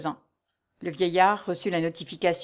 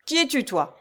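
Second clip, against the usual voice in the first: high, curt harsh demand; brutal question; 6 semitones higher than usual. A narrator's reading. Steward harshly questioning the girl. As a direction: bark the question down at her. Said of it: "demanda-t-il brutalement".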